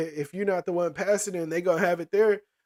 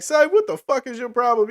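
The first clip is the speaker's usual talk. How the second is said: raised, higher voice, imitating a friend's challenge; mock yell; 6 semitones higher, 5 dB louder than usual. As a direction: bark it up high like a rowdy friend. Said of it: "one of the homies over here was like".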